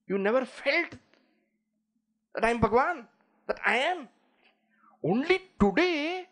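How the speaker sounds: high, slowing, reproachful god's voice; Ganesha speaking. Retold or imitated. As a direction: reproach him gently from on high, rising as you go. Ganesha answers the devotee.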